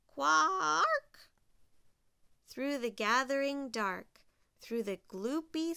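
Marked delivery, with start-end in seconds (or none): 0.1-1.3 long, loud high caw; drawn-out bird cry rising at the end; crow squawk. A narrator's reading. Crow's call joins the sounds.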